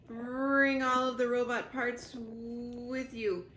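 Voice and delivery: slow, broken, stammering machine voice; dying robot warning Roz, slow and stuttering; garbled dying robot. Acted. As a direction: force out each word as the circuits fail. Dying robot's last orders.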